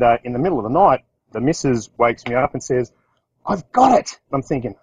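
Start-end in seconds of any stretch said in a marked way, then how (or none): 3.5-4.2 high, eureka-bright announcement; wife shaking her husband awake with a brainwave, excited. Retold or imitated. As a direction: blurt out a sudden idea. Wife wakes him with an idea.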